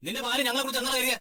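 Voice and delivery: high, indignant challenge. Acted. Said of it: "എന്തിനാ നീ ഇങ്ങനെ ഒച്ച വെക്കുന്നത്?". (ദേഷ്യത്തോടെ ചോദ്യം ചെയ്യുന്നു)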